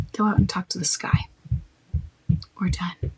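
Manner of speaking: calm, final and unhurried; firm, not rude. Shown as a demonstration. Shutting down someone pushing his accusations, calm and firm. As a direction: close the topic without heat. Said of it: "And you be firm, not rude".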